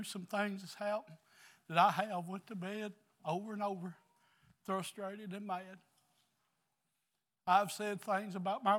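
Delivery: high, impassioned preaching voice. Acted. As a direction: lift it up like a revival sermon. Preaches at full force.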